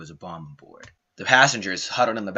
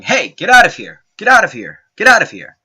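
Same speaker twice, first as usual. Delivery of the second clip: loud, high yell, repeated urgently; imitated yelling; 11 semitones higher, 14 dB louder than usual. Someone yelling at intruders in the cockpit, imitated loud yelling. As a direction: shout it out as in a struggle. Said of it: "someone yelling".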